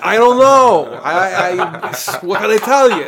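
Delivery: put-on loud, high, laughing Brooklyn accent; hammed-up Brooklyn drunk making excuses. Answering grad school friends, mock drunk Brooklyn accent. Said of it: "sound like you're from Brooklyn when you're drunk".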